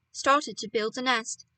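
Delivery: usual speaking voice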